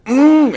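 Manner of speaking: high, loud stifled hum of pain; muffled yelp behind the ice cream; pained muffled cry. Retold or imitated. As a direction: squeal through a full mouth. Alcohol hits the wound.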